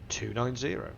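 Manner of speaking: ordinary delivery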